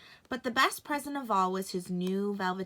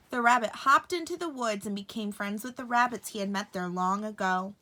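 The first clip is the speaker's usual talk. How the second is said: ordinary delivery, nothing marked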